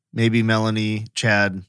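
usual speaking voice

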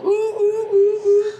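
very high whooping ooh sounds; siren whoops through megaphone. Retold or imitated. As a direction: imitate a siren wail. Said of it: "he's going".

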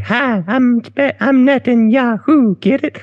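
high, squeaky, slow imitation; exaggerated high, childlike star impersonation. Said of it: "almost like a [name] voice".

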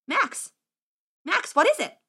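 high-pitched urgent question; alarmed boy wizard. Acted. Wizard questioning gaping squire, alarmed.

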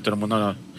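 ordinary talk

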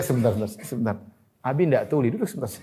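low, soft mock pleading; calm husband's plea. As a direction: quietly ask a shouting spouse to wait. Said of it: "Pelan-pelan, pelan, nggak usah apa".

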